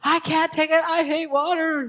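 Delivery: high, loud crying tantrum; whining child. Shown as a demonstration. Child refusing bath, crying and shouting high. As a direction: wail it like a toddler refusing the bath. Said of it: "They start arguing".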